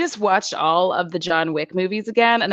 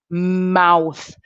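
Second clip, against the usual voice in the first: ordinary delivery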